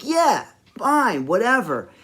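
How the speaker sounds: high, falling brush-off; fed up. Retold or imitated. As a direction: mimic her fed-up giving-in. Retold girl fed up with stalling.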